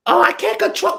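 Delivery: high squeaky mock of her excuse; shrill-sounding put-on voice mimicking her; imitating her in a high voice; mocking falsetto. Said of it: "mock quote of listener".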